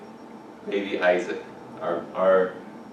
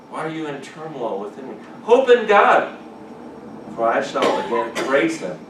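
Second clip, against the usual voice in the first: psalm reading; 5 semitones higher, 5 dB louder than usual. Acted.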